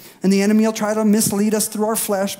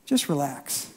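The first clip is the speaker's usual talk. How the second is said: calm relax; 6 semitones lower, 8 dB quieter than usual. Shown as a demonstration.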